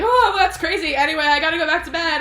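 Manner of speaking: high, loud, rushed excuse; mock panicked brush-off. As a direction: blurt it out to escape, exaggerated. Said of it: "Henry kinda, like, flips out".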